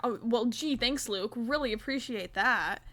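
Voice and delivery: loud, exaggerated mock thanks; hammed-up thankfulness, key words punched; sarcastic gratitude. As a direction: gush with playful sarcasm. Mock gratitude for keeping own account.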